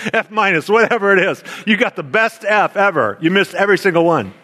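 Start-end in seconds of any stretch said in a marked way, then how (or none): none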